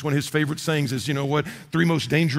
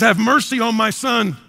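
pleading father; 5 semitones higher, 5 dB louder than usual. Retold or imitated. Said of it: "He says".

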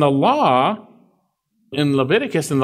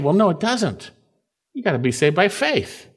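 ordinary talk

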